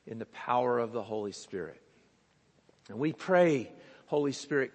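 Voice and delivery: hushed, humble prayer voice. Acted. Pastor leads the congregation in prayer before reading, reverent.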